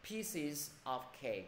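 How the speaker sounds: "pieces of cake" slowly, with gaps between words; unlinked, word by word. Shown as a demonstration. Teacher to students who can't link yet, separated words. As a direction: keep "pieces", "of" and "cake" apart. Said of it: "ai chưa đọc nối được thì cố gắng đọc là".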